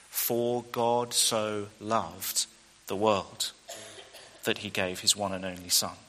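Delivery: a hushed, slow John three sixteen; tender. Shown as a demonstration. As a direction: let it land gently and reverently.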